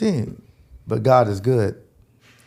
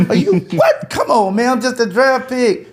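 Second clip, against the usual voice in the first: incredulous; 9 semitones higher, 3 dB louder than usual. Retold or imitated. Mock disbelief at the crowd.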